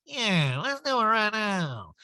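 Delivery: put-on high, slow drunken drawl; hammed-up boozy readiness; mock drunk diva. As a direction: agree like a sloshed diva, dragging it out. Recapper mimics drunk Erica answering.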